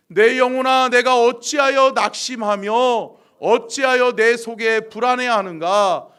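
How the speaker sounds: forceful, ringing congregational reading; loud scripture recitation. Acted. Pastor leading congregation in reading psalm, forceful recitation. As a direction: declaim the verse loudly, stretching the ends.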